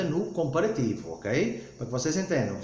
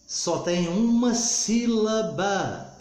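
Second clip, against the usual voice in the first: "uma sílaba" drawn out slowly, beat by beat, higher; emphatic slow teaching; 4 semitones higher, much slower than usual. Shown as a demonstration. Teacher repeating the classroom rule, "uma sílaba" stretched out.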